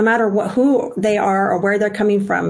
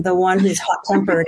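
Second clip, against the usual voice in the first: ordinary talk, nothing marked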